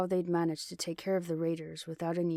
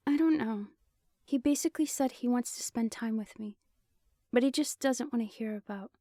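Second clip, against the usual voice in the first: higher, hesitant female voice; uneasy young woman, pitched up; 6 semitones higher than usual. A narrator's reading.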